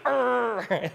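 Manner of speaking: loud high frustrated "arr"; angry roar. Retold or imitated. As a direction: snap back into rage. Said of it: "she goes back".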